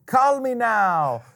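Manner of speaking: slow high Jamaican fortune teller voice; drawn-out Jamaican TV psychic; Jamaican accent. Retold or imitated. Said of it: "she had this thick Jamaican accent".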